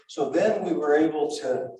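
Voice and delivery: normal talking voice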